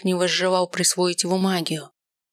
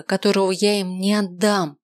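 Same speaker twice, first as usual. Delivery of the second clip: ordinary delivery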